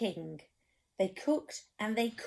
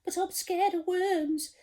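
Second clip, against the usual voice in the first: high, trembling scared cook voice; 10 semitones higher than usual. Acted. Storyteller voicing the cook, scared of the sea, high voice. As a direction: quiver like a frightened wimp. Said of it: "the cook declared".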